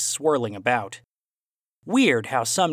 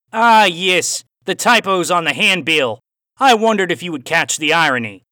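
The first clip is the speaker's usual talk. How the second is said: louder, raised Aussie voice with a cowboy twang; Aussie cowboy accent; 5 semitones higher, 9 dB louder than usual. A narrator's reading. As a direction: play the villain Aussie, hint of cowboy twang. Villain's dialogue in story.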